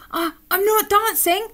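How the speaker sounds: high-voiced bear in denial; protesting little bear. Alan denying to Giraffe he is dancing, protesting bear voice.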